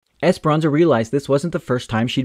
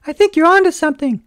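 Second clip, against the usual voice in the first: high, gentle mother voice; a much higher, kind mama voice; Mama's voice; 6 dB louder than usual. A narrator's reading. Mother admiring her daughter's find, high and gentle.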